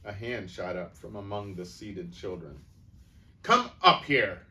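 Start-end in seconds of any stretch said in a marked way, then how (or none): 3.4-4.4 much louder and higher shout. A narrator's reading. Headmistress ordering the boy up, loud shout. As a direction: order him up with a yell. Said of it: "the Trunchbull shouted".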